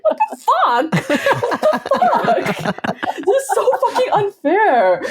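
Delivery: put-on very high, laughing kid's indignation; mock outrage. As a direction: shriek the unfairness through laughter. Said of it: "And we're like".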